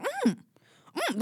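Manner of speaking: high, savouring mock hum. Imitating a singer's genuine appreciation of wing sauce, high hum. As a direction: hum approval like an imitated taster. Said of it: "And she's like".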